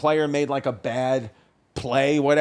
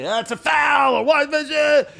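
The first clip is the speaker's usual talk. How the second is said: mock parent yelling; 8 semitones higher than usual. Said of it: "yelling the whole game".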